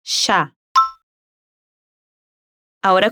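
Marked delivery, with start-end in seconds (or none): 0.0-0.5 ya said as "sha", with an sh sound; Rioplatense ya. Shown as a demonstration.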